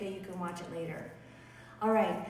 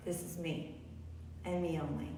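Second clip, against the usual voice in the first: soft inner voice; 4 semitones lower, 9 dB quieter than usual. Pretend. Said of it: "it is a symbol of".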